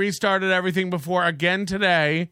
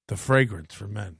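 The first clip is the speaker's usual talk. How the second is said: deep, soft cologne tagline; sultry perfume ad; 9 semitones lower, 4 dB quieter than usual. Pretend. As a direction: breathe the tagline like a fragrance ad.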